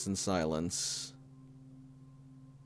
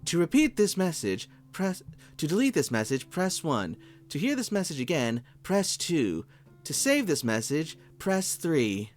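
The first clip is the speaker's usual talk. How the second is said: higher, brisk recorded menu voice; automated phone menu; 8 semitones higher than usual. Acted. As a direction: rattle off the menu like a machine.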